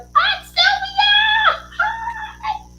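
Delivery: very high, slow squealing shout; squealed greeting. Real, not acted. Host to Aunt Sylvia, squealing a greeting. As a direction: call out hi in a shrieking sing-song.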